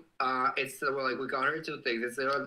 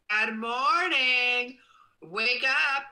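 high, loud, drawn-out wake-up yell; screaming good morning; 10 semitones higher, 6 dB louder, much slower than usual. Shown as a demonstration. Streamer demonstrating an alarm for viewers, screamed wake-up call. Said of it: "one of your screaming, "Good morning".

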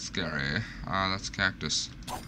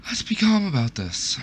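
higher, put-on soothing voice; mock calm self-talk; 9 semitones higher than usual. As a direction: talk yourself down in a silly voice. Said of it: "Take a deep breath".

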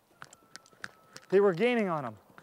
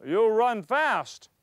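slow, loud, deliberate chief's words; stern chief; 4 dB louder, much slower than usual. Retold or imitated. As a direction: drag it out like a menacing chief.

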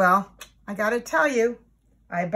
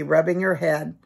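ordinary delivery, nothing marked

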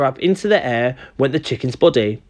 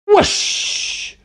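high, airy squawked whoosh; chicken's shriek. A narrator's reading. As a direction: shriek it like a startled bird. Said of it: "shrieked the chicken".

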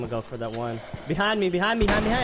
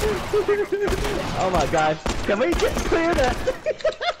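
disbelief; 12 semitones higher, much slower than usual. Real, not acted. Downed, teammates fail pickup.

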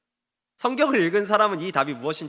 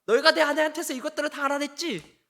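retold angry accusation; 6 semitones higher than usual. Samson accusing the friends of getting it from his wife, mock angry. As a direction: accuse them in a mildly angry, raised pitch. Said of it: "갑자기 화를 냅니다".

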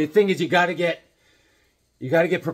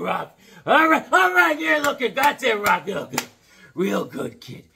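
Mickey yelling ringside; 9 semitones higher, 5 dB louder than usual. Retold or imitated. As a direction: bark encouragement at a boxer from the corner. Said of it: "We'll do a little Mickey".

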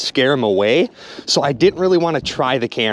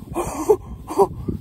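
high astonished gasp; 13 semitones higher, 4 dB quieter than usual. Real, not acted. Amazed at perch.

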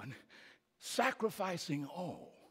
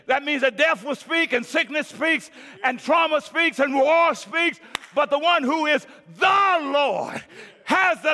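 loud, high, shouted sermon climax; preaching at full force; 10 semitones higher, 15 dB louder than usual. Acted. Pastor rousing his congregation at Christmas, full-force shouted preaching. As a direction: whip the church up with a ringing shout.

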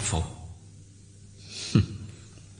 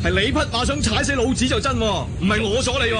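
disguised as officer; 10 semitones higher than usual. Acted. Disguised swordsman blustering at a rider as officer. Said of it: "扮呢個軍官都幾有趣啊".

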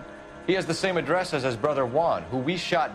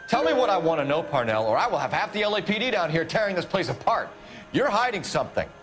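raised, forceful interrogation outburst; angry threat; 6 semitones higher, 3 dB louder than usual. Acted. Detective threatens to have the factory torn apart, angry threat. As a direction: pitch it up and lean hard on the suspect.

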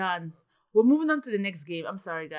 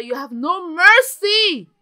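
mock outrage; 6 semitones higher, 12 dB louder, slower than usual. Real, not acted. Mock-outraged at Sims game.

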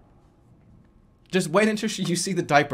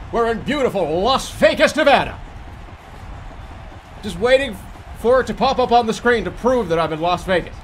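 excited announcer; 5 semitones higher, 3 dB louder than usual. Acted. Hyped tour-guide intro.

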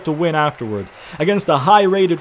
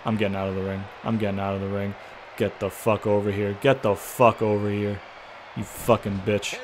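lower, softer angry grumble under the breath; muttered cursing; 4 semitones lower, 7 dB quieter than usual. Real, not acted. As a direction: swear quietly so neighbours don't hear. Cursing at opponent late at night.

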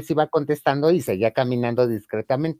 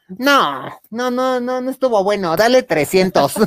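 mock high protest; 8 semitones higher, 5 dB louder, slower than usual. Drunk friend refusing.

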